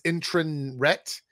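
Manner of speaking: "internret" drawn out, ending in "ret"; misspelling read literally. Shown as a demonstration. Mocking the video title's typo, misspelling read literally. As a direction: slowly sound out the typo "internret". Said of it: "I'm assuming they mean internet".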